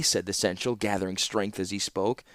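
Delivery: ordinary delivery, nothing marked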